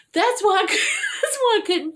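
high, loud laughing realization. Real, not acted. Realizing the back tire was flat, laughing. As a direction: laugh it out at the punchline.

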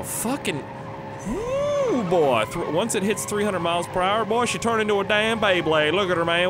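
whooping, high-pitched hype commentary; excited yelling. Real, not acted. Gamer watching his car spin like a Beyblade, excited yelling. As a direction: holler it as the car goes wild. Car spins wildly in the game.

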